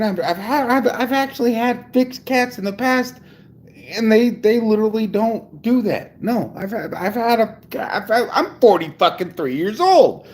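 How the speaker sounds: high-pitched, stammering indignation; worked-up disbelief. Real, not acted. Ranting about the cat's behavior.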